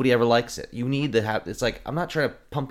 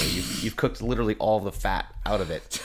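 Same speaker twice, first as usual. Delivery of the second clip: normal talking voice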